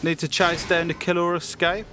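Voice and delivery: ordinary talk, nothing marked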